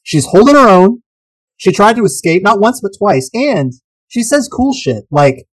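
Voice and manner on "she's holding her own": ordinary talk, nothing marked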